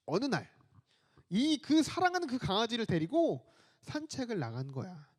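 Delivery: normal talking voice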